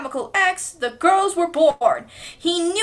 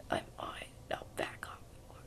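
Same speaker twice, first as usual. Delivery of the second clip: unvoiced threat; whisper; 21 dB quieter, faster than usual. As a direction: hiss a threat softly. Whispered line.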